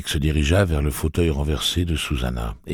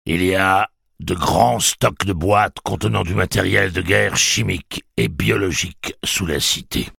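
cold machine announcement; 7 semitones higher, 6 dB louder than usual. Acted. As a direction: announce doom coolly, slowing down. Blaine announces the gas.

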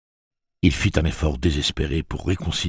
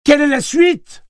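shrieked, urgent question; hurlement; 9 dB louder than usual. A narrator's reading. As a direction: yell it very high and loud, demanding an answer. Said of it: "hurla Susanna". (question hurlée avec urgence)

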